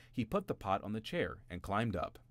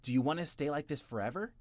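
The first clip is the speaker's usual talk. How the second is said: brother asking; 7 semitones higher, 4 dB louder than usual. A narrator's reading. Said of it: "his middle brother asked".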